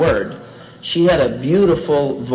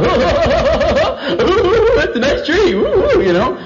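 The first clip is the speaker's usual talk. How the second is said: high, very drawn-out goofy hooting; hammed-up silly admiration; doltish Adam; 12 semitones higher, 3 dB louder, much slower than usual. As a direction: play a dim, wowed Adam. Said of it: "but he was going".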